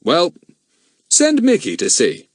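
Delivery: a lightly voiced grown man, grave; steady adult man puzzling it out; a hint of a serious man; Bill's voice. Bill answers.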